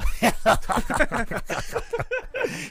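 high giggly sinister laugh; creepy laugh. Shown as a demonstration. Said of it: "I have a creepy laugh".

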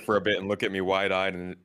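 ordinary talk

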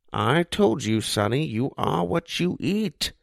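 lightly voiced old man, knowing; a hint of a folksy old owner; wise old pizza man. A narrator's reading. Old Pete lecturing Josh, lightly voiced kindly old man.

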